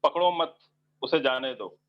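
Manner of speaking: "पकड़ो मत" as one unit, pause, then "उसे जाने दो"; pause after मत. Shown as a demonstration. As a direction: break after मत, let "उसे जाने दो" fall. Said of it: "के बाद कॉमा लगाया".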